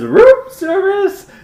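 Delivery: put-on very high, slow German-accented room service; high slow German caller voice; German-sounding voice. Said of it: "And she says".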